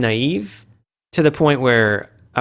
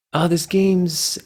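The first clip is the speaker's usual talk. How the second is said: imitated fan; 6 semitones higher, slower than usual. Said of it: "people going like".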